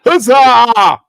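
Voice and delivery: high, loud drawn-out huzzah; big hammed-up shout; triumphant cheer. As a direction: cry out a mock victory cheer. Mock cheer after long story.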